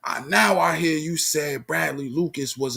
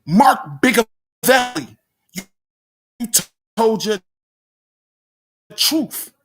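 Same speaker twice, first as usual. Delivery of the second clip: emphatic callout; 7 semitones higher, 4 dB louder than usual. Real, not acted. Final callout to Mark.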